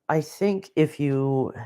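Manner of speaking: ordinary talk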